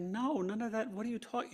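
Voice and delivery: high, laughing disbelief; baffled wife voice. Retold or imitated. Wife denying any man was there, high and baffled.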